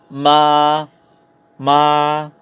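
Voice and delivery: MA on one level, sustained note; first tone. Shown as a demonstration. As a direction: hold "ma" steady like a sung note. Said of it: "tinatawag na singing noti-, intonation".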